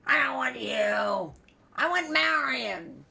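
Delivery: loud, high demanding holler; retold yelling. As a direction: call out demandingly at full volume. Retold father yelling from bed.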